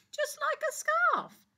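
high, slow sing-song; playful punchline. A narrator's reading. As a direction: land the joke brightly. Punchline of the line.

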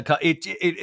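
ordinary talk

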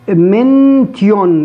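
منتيون drawn out, loud, with a t sound; wrong mention. Shown as a demonstration. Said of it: "لا، لا، لا، لا". (نطق منتيون الخاطئ ببطء وبصوت عالٍ)

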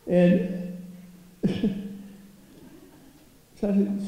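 usual speaking voice

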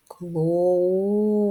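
"Клоун" slowed, leaning into the У after the О; sounding out. Shown as a demonstration. Teacher sounding out clown to find the next sound. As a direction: stretch "Клоун" so the У comes through. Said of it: "слухаємо, який наступний після О".

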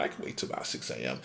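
fast, hushed whisper to himself; frightened whisper. Acted. Guard hiding in his secret room whispers to himself, fast frightened whisper. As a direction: whisper it quickly, hoping nobody hears.

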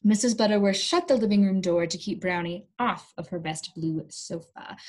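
plain reading voice

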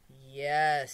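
hint of a hypnotized trance reply; low voice. Hypnotized woman answering the doctor, low inner voice. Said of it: "in a low voice from deep inside her".

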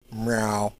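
a low, lazy meow in a cat's voice; mock cat meow. Mocking a passive tank with a low cat meow. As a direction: meow like a bored house cat.

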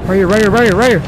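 frantic chase chatter. Real, not acted. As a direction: blurt it over and over in panic. Fleeing, spots the way out.

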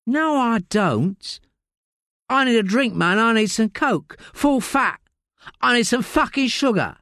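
high, coarse London voice of a desperate addict; Layla, rough addict. Acted. Addict Layla snapping at detective Josie in interview. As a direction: snap like a strung-out woman in custody.

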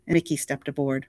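plain reading voice

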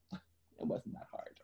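breathy whisper with a laugh; relieved, laughing whisper. Retold or imitated. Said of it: "you're like".

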